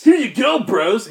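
high, loud, stoked bro creature; Fugamon. Acted. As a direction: exclaim it like a delighted dude. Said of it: "and Fugamon will answer".

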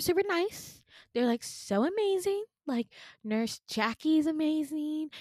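high, bubbly praise; mock gushing. Nurse praising her colleagues to a patient, high mock gushing. Nurse gushes about the staff.

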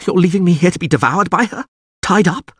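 high, fast, alarmed questioning; panicked protest. A narrator's reading. Captive learns he is left for the beast, panicked. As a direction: blurt it out in horrified disbelief. Captive fears being devoured.

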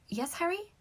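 high, soft, rising query; reply lightly, unsure what is wanted; confused. A narrator's reading. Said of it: "with a look of confusion".

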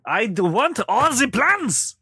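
slow, high mock German accent; a sudden German accent, slowly. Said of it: "I switched to German for a moment".

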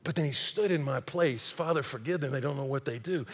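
soft, low, tender quoting; hushed reverence. Retold or imitated. Preacher quoting Jesus's words from the cross, hushed reverence. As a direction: say it gently, in awe.

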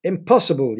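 loud high protest; yelling. A narrator's reading. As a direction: yell it in outrage. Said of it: "yelled the second young man".

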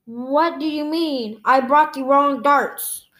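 plain reading voice

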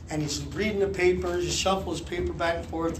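ordinary talk

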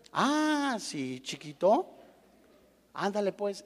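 burla suave y baja, como a un niño; búrlate con condescendencia; burla condescendiente. Retold or imitated. Hermanos burlándose de José. (burla tranquila y despectiva)

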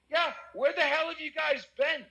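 high-pitched exasperated mock outburst. Drunk guest greeting arriving police cruisers, exasperated. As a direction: act out an indignant call to latecomers, pitch way up.

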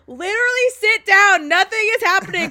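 loud, high stern teacher; stern teacher shout. Retold or imitated. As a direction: yell at the class to sit down. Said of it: "my teacher was like".

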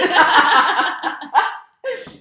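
loud, high burst of laughter. Real, not acted. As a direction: crack up loudly. Laughing at galaxy light.

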